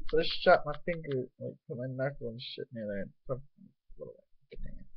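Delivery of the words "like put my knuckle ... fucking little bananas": ordinary delivery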